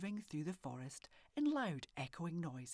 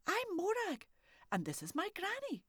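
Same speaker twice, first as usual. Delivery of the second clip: put-on high, bright child's voice; a curious little girl asking boldly; little girl Morag; 4 dB louder than usual. Morag asking the old man if he's a haggis, high child voice.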